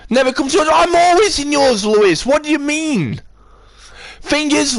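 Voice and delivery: angry rant. Real, not acted. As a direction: vent the frustration, pitch climbing high. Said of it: "why do you think I'm angry".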